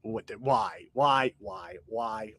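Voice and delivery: normal talking voice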